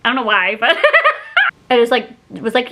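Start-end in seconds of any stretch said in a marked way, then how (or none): 0.8-1.6 squeaky laugh at herself; high giggle. Real, not acted.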